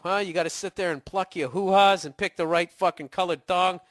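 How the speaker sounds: ordinary talk, nothing marked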